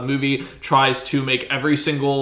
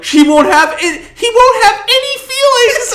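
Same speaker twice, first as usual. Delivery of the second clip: much higher, loud, cracking up; laughing mock protest; 6 dB louder than usual. Mocking the scientists' protest while laughing. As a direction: squeal the objection while laughing. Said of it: "it's like".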